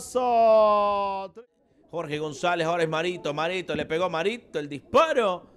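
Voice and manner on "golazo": drawn-out goal call. Real, not acted.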